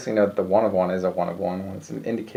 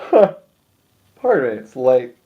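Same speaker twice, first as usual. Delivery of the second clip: yawning; 3 semitones higher, 6 dB louder, much slower than usual. Real, not acted. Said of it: "I'll apologize for yawning".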